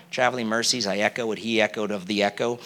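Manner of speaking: low and soft, a mock Southern prayer; Southern accent. Preacher parodying the echoed prayers in a Southern accent. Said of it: "I'm trying to do a bit of an accent".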